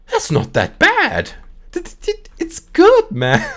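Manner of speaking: very high, loud squeaky impression; mock drunken approval. Drinkers tasting the shark in the story, squealed mock approval. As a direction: stammer it out in a comic falsetto.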